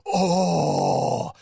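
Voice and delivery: loud, high, drawn-out "oh" of a crowd; long mock crowd cheer; imitated crowd roar. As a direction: erupt like an exploding wrestling crowd. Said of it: "It exploded. Everyone was like".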